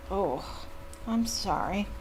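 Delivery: plain reading voice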